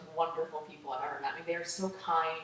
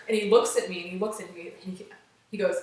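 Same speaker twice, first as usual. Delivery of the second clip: usual speaking voice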